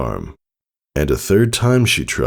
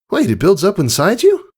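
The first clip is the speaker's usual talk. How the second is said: put-on much higher, startled young voice; alarmed filly; 4 dB louder than usual. Filly realising danger, high startled question. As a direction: catch on with a jolt of worry.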